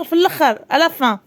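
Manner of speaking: ordinary talk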